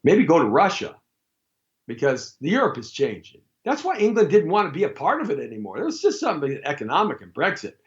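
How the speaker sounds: usual speaking voice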